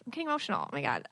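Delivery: overcome, almost breaking off; real emotion. Real, not acted. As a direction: voice catching as the memory hits. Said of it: "even now I'm getting emotional".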